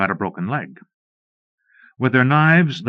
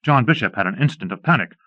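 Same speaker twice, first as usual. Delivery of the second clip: usual speaking voice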